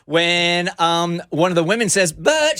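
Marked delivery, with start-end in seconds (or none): 2.1-2.6 put-on "But" lifted much higher in a light foreign accent; a hint of her accent on "But"; mock accent. Host imitating neighbor with a light accent. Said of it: "she's got, like, an accent".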